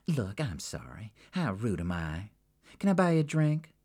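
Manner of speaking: soften, then perk up; Jackie, contrite. Acted. Jackie to Sabina, apologizing then offering a drink.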